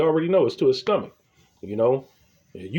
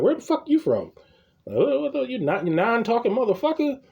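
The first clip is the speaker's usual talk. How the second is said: mock outrage; 6 semitones higher than usual. Insulting clerk in retelling.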